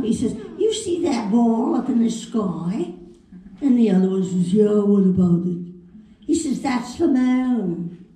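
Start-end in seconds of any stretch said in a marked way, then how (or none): 0.6-2.9 put-on dim-witted London accent. Twit pointing something out, London accent. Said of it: "two twits what are sitting". 4.5-5.6 gruff London twit's reply; London accent. Retold or imitated. Second twit answering his mate, London accent. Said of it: "two twits what are sitting". 6.6-8.0 put-on slow, drawn-out London accent. Twit telling his mate, slow London accent. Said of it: "two twits what are sitting".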